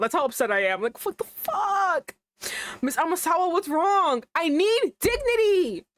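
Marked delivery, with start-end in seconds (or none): 0.8-2.2 slow, very high sob-laden what the fuck; real tearful disbelief; crying. Real, not acted. Upset, crying sound heard. 2.8-5.8 real tearful whine; tearful wail. Real, not acted. Distraught reaction to character.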